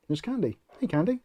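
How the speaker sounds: usual speaking voice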